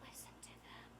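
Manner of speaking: very soft whisper; hushed plug to listeners; whispered aside. Real, not acted. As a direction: slip it in under your breath. Whispered aside about the bands.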